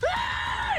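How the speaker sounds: piercing airy stallion scream; screaming fiery steed; high horse scream. A narrator's reading. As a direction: let out a high, unearthly scream. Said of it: "screams as, like, fire and light".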